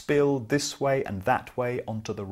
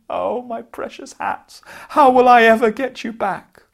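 high wailing cry, rising louder; crying lament; 10 semitones higher, 7 dB louder than usual. Acted. Hat seller crying to himself, high wailing lament. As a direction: wail over the lost hats. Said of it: "began to cry".

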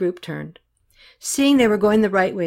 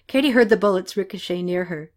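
usual speaking voice

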